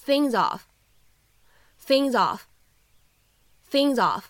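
things of linked as thing-zof, said slowly; slow linked demo. Shown as a demonstration. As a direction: slide the z of things into of. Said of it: "可以读成". (things of 慢速连读)

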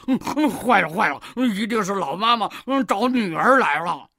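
grunting pig character in a panic; flustered. A narrator's reading. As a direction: fret loudly with nervous grunts. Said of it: "八戒慌了". (猪八戒慌张地哼哼着说)

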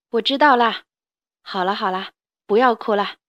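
put-on calm a crying child; soothing father. 爸爸安慰孩子. (霸王龙爸爸哄孩子别哭)

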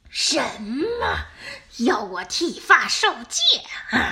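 taken-aback wolf questioning; startled disbelief. Acted. Wolf learns he must shave his head, startled. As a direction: blurt it out in shocked disbelief.

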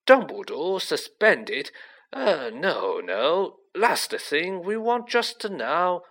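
slow, flustered disbelief; lightly voiced worried official, stammering objections; a touch of alarm, drawn out; mildly alarmed. A narrator's reading. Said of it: "looking alarmed".

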